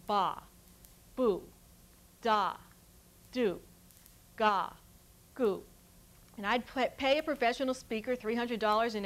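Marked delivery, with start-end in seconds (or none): 0.1-5.6 ba, boo, da, do, ga, goo each said apart as a clean isolated syllable; syllables spoken singly. Shown as a demonstration. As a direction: sound out ba, boo, da, do, ga, goo one at a time with pauses. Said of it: "record thousands of syllables like".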